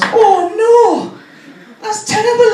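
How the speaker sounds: high, loud Welsh dismay; Welsh accent. Retold or imitated. Welsh nurse hearing of a drug problem, aghast Welsh accent. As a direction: wail it in a hammed-up Welsh lilt. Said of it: "she's also from Wales".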